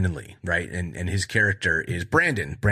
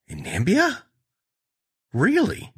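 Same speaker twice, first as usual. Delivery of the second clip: high, disbelieving question; real surprise; 11 semitones higher than usual. Real, not acted. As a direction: pitch it way up in honest disbelief. Misreads country, doubts it.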